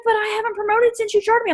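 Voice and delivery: high, plaintive complaining protest; whiny complaint. Retold or imitated. Alana protesting to her upline, high whiny complaint. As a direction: whine it back like a sulky downline. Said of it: "She's like".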